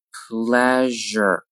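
"Pleasure" slowly with a held zh; slow careful "Pleasure"; drawn-out model. Shown as a demonstration. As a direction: stretch "Pleasure" out for learners. Teacher models word slowly, then normally.

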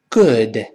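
good with a short, relaxed vowel, falling. Shown as a demonstration. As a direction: model good without pushing the lips forward. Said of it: "跟老师读". (good 短音示范)

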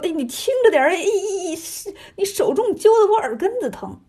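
high, whiny acted ow-ow protest; pained yelping. A narrator's reading. Pig demon dragged by the ear begs for gentleness, pained yelping. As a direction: yelp and whine as if tugged by the ear. Pig's ear is being pulled.

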